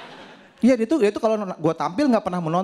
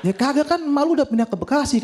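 high, laughing reply to his friend; blurting the news with a laugh high in the voice; excited retort; 10 semitones higher than usual. Retold or imitated. His own excited reply, retold. (menyahut tinggi sambil tertawa)

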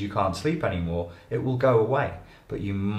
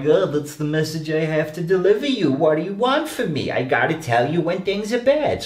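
higher, put-upon messenger voice; voice of the emotion messenger; 8 semitones higher, 3 dB louder than usual. Acted. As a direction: play the weary messenger who must bring bad news. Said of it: "they're like".

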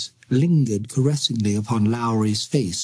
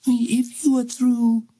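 put-on higher little-girl voice, slow and deliberate; a ghost child getting down to business; 9 semitones higher than usual.

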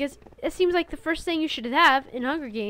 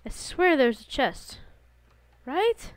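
ordinary talk

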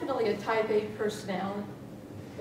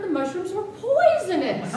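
laughing; 8 semitones higher, 6 dB louder than usual. Acted. Confessing poisoning, starts laughing.

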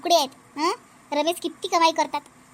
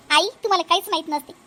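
normal talking voice

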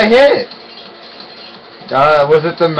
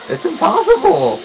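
very high-pitched protest; exasperated squeal; 13 semitones higher than usual. Real, not acted. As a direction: squeak it out in disbelief. Complains about the hard game.

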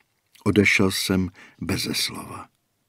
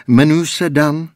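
insincerely friendly; 7 semitones higher, 8 dB louder than usual. A narrator's reading. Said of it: "s neupřímným úsměvem".

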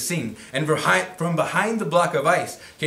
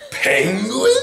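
surprised; 9 semitones higher, 6 dB louder than usual. Acted. Tacky's surprised question.

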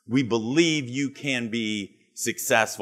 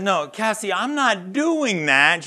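high, slow, laughing refusal; husband refuses to go on the show, high and slow; mock refusal; 8 semitones higher, slower than usual. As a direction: dig in and refuse, half laughing.

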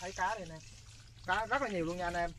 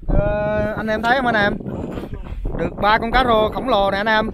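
loud, thrilled showing off; excited shout; 11 dB louder than usual. Real, not acted. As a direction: call out the big catch proudly. Showing huge fish catch.

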